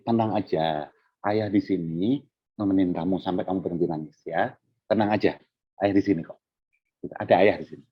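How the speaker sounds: put-on soft, low-pitched comfort for a child; soothing father. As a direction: reassure a crying little one gently. Father soothing his crying child.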